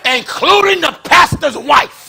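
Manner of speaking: fiery shouted preaching. Acted. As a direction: preach it hard to the pews. Preaching to the congregation.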